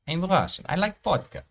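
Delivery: very high mock Russian accent; hammed-up Russian accent, pitched far up. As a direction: play a squeaky-high comic Russian. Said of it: "the accents".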